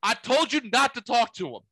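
high, loud imitation of an upset sister; mock-frantic "told you so" outburst; exasperated retold scolding.

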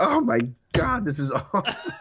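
real delight breaking into a high pitch; amazed. Real, not acted. Co-host hearing about rhyming slang, amazed and delighted.

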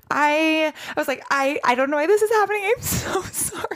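high, tearful rushed apology; retold sobbing apology to a client; crying apology. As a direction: blurt it out through tears and giggles. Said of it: "I was crying".

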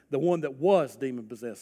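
ordinary talk

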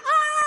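high, loud comic scream; mock shriek. Mary sees the body is gone, mock shriek. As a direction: shriek in hammed-up horror. Mary finds the tomb empty.

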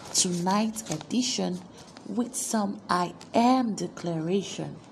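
normal talking voice

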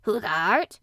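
loud, wavering echoed question; queer, shaky voice. A narrator's reading. Stunned gardener answering the boy, shakily. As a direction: answer shakily, taken aback. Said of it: "answer in a queer, shaky voice".

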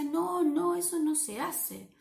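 scolding warning. Acted. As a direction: wag a finger with your voice. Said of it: "y Miedo le decía".

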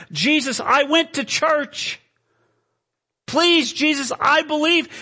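high, loud, anguished plea; desperate pleading. Acted. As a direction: plead for your life. Acted sinner pleads with Jesus.

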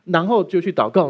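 ordinary delivery

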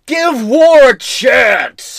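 drawn-out high shout of a slogan; slow shouted slogan. Pretend. Host shouting a mock antiwar slogan, slow and loud. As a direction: chant it like a mock rally cry. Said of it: "Shout out if you know the reference".

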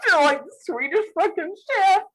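can't stop laughing while trying to talk; helpless giggles. Real, not acted. Host mocking own botched pronunciation, helplessly giggling. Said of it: "I can't stop".

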